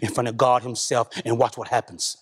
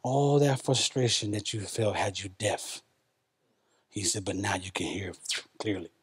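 soft voice of God; 7 semitones lower, 9 dB quieter than usual. Retold or imitated. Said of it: "And God said".

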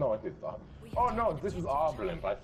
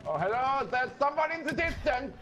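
put-on higher, chirpy German accent; 12 semitones higher than usual. Spotting an enemy far away, in a playful German accent. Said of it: "The German accent is on point".

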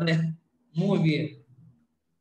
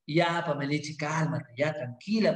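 soothing; 8 semitones lower, 4 dB quieter than usual. Pretend. Teacher soothes crying pupil.

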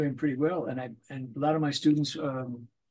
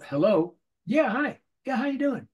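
hello rattled off fast and higher; quick phone answer; 9 semitones higher, 5 dB louder than usual. Shown as a demonstration. Showing how a cartoon actor must answer the phone. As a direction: snap it out with no air between. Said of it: "You have to go".

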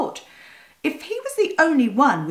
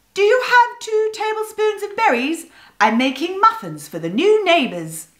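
magpie voice; 9 semitones higher than usual. A narrator's reading. Miss Pye voiced.

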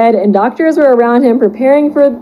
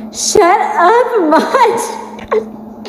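laughing; 10 semitones higher, 3 dB quieter, much slower than usual. Real, not acted. Laughing while reading the fanfic.

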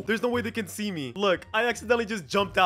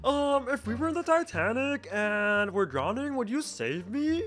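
slow, soft imitation of a girl; put-on slow voice, hesitant opening, questioning end; Dixie voice; 3 dB quieter, slower than usual. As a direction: read it slowly and softly as her impression. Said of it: "why is she talking in the Dixie voice".

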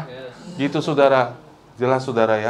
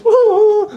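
loud, high-pitched fake bawling; exaggerated comic sob; mock crying wail; 7 dB louder than usual. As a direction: wail like a hammed-up crybaby. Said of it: "Saya datang, saya nangis".

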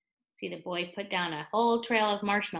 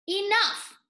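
fed-up villagers; 9 semitones higher, 5 dB louder than usual. Acted.

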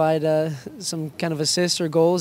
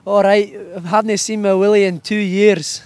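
loud, high Scottish Fat Bastard voice; 5 semitones higher, 8 dB louder than usual. Acted. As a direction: boom it out in a thick Scottish brogue. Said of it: "You do a good fat bastard?".

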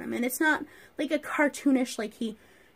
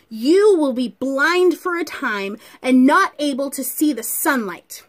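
stern rebuke; 4 semitones higher, 9 dB louder than usual. Acted. Saul rebuking the sorcerer Elymas, loud and stern. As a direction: thunder it like a prophet condemning a liar. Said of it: "I'm gonna try my best rebuke voice".

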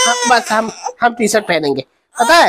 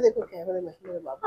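soft coaxing; 12 dB quieter than usual. Real, not acted. Father softly coaxes baby to look at camera. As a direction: hush it down to a gentle coax.

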